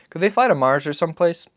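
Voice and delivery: put-on high, light child's voice; a small boy asking his father; boy's voice. Narrator voices the boy asking Papa, high and childlike.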